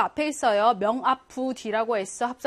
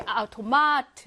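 아우토마트 with 마트 stressed and drawn out; stress on third syllable; slower than usual. Shown as a demonstration. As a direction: save the weight for 마트 in 아우토마트. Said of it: "삼 강세에 오거든". (아우토마트의 '마트'에 강세)

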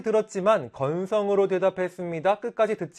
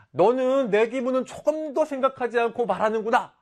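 raised, higher hurt complaint; angry retort; 6 semitones higher than usual. Acted. Boy scolds his classmate for ignoring his feelings, angry. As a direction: let the boy's hurt turn into anger. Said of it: "화를 내니까".

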